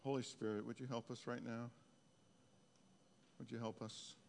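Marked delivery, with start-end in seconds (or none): none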